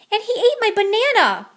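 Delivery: high, loud complaining sister; complaint. A narrator's reading. Jezebel tattling to mama, high complaint. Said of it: "complained Jezebel".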